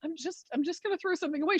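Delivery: soft, high and quick; imitated puzzled innocence; mock meek protest. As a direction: explain timidly in a small hurried voice. Acts out her puzzled meek reply.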